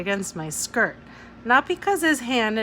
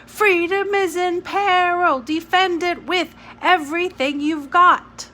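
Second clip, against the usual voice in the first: higher, louder cry; excited shouting; 10 semitones higher, 7 dB louder than usual. Acted. Jamie waving his arms, shouting the slogan. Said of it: "waved his arms wildly".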